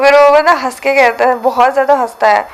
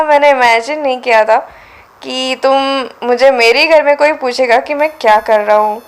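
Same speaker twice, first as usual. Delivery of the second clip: plain reading voice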